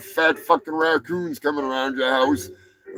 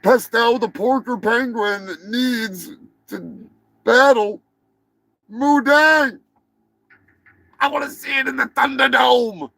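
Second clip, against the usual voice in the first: fight hype announcer; 7 semitones higher than usual. Pretend. Host demanding penguin battle hippo, over-the-top announcer. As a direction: hype the showdown at high pitch.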